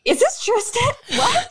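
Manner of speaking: very high, drawn-out incredulous question; amused disbelief. Retold or imitated. Friend retelling shock at a text after brain surgery, incredulous. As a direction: squeal it in mock shock, laughing. Retold shock at his texts.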